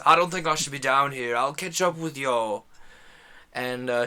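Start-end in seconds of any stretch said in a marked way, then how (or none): 0.1-2.7 loud, badly faked Scottish voice; bad Scottish accent. Uneasy ranger to the party, loud shaky-grasp Scottish accent. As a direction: attempt a Scottish ranger, firmly. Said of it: "This is a terrible version of this person's voice".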